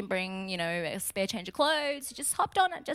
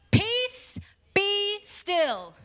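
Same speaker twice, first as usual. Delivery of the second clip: high, raised command, each word apart; commanding rebuke; 9 semitones higher, 4 dB louder, much slower than usual. A narrator's reading. Jesus rebuking the wind and sea, commanding. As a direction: order it firmly with pauses between.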